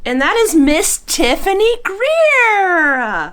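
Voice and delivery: high, slow, excited reveal; excited announcement. Real, not acted. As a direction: announce the winner with glee. Announcing giveaway winner.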